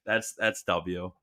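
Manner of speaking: ordinary delivery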